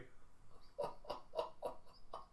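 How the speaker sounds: breathy unvoiced laugh at the twist; real laugh. Real, not acted. Narrator laughing at the plot twist, real laugh. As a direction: laugh it off in disbelief.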